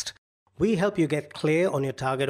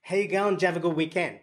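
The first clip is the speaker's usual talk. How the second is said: broad Aussie, words run together; very ocker Aussie; 3 semitones higher, faster than usual. Shown as a demonstration. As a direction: lay the Australian accent on thick. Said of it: "be very ochre about it".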